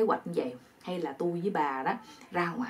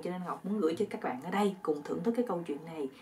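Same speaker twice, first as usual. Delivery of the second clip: usual speaking voice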